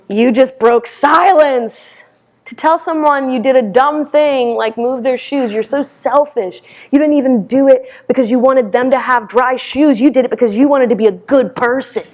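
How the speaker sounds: high-pitched, acted scolding; harsh inner critic. Guilt berating the meditator for breaking silence, high and scolding. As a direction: lay into them like a merciless guilty conscience. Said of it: "guilt and shame really showed up".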